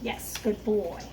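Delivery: ordinary delivery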